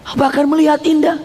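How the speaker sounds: usual speaking voice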